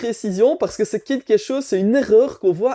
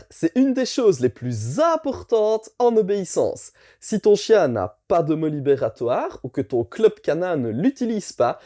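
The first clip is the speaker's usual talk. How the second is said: normal talking voice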